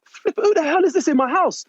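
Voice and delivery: high-pitched, alarmed exclamation; startled outburst. Retold or imitated. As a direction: blurt it out in shock. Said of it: "I'm like".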